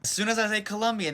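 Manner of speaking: ordinary delivery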